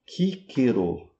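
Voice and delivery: "Cicero" slowly as KEE-keh-roh; both Cs in "Cicero" as hard k; Latin pronunciation. Shown as a demonstration. Teacher answers how Latin said the name, hard k in "Cicero". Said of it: "como é que é pronunciado em latim?".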